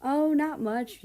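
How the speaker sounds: mischievous. Acted. Yuki teasing the new kid about school, mischievous. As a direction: tease her with a sly drawn-out brush-off. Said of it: "Yuki smiles mischievously".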